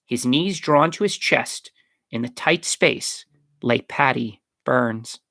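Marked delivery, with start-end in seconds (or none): none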